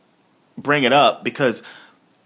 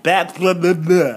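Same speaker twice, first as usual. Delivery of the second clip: mocking babble; 3 semitones higher than usual. Shown as a demonstration. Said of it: "I can't even talk today".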